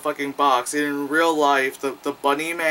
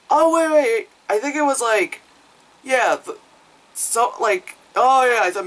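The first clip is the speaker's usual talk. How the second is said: sudden recall; 9 semitones higher, 4 dB louder than usual. Real, not acted. Suddenly remembers the plot.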